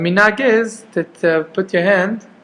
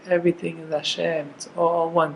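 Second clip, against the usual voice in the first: to himself; 8 dB quieter than usual. Shown as a demonstration. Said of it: "To himself".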